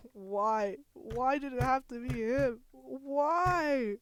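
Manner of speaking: loud, high mock despair, rising with each why; mock anguished wail. Gamer wailing over a favorite character's death in hammed-up grief. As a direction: wail the why like a melodrama mourner.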